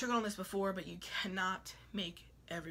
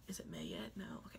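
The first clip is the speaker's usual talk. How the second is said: deep whisper; 13 semitones lower, 8 dB quieter, faster than usual. Retold or imitated. Talking to frozen cake.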